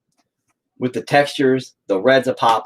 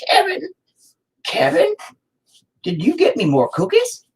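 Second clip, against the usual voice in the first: put-on crazy old man. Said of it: "I'll be like".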